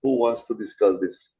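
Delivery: ordinary talk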